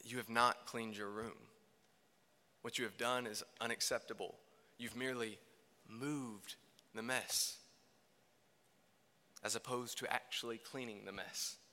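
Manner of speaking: a disappointed mom's quiet scolding; lightly voiced stern mother; frustrated mom. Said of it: "this slightly frustrated tone in my mom's voice".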